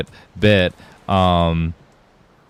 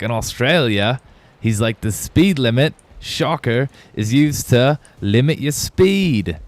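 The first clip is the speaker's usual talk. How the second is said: imitating a comedian's Australian accent, higher; 5 semitones higher than usual. Fan retelling a comedian's joke, mock Australian accent. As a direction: retell the bit in a mock Aussie voice, slow on the punchline. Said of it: "how's the Australians always say it".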